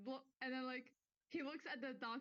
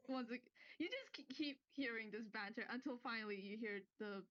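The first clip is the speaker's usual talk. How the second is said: ordinary delivery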